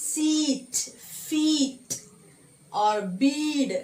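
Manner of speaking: "Seat", "feet", "bead" with long held ee; long vowel. Shown as a demonstration. Teacher giving examples of E sound, each word drawn out. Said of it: "can you say other examples for E sound?".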